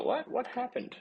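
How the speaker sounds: high, startled what-happened; startled confusion. Acted. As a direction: jump up in pitch, baffled. Humans confused by stalled bulldozer.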